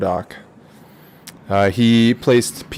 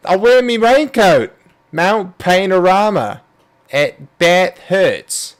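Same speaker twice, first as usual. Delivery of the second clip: mock Aussie; 4 semitones higher, slower than usual. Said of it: "Aussie greatness".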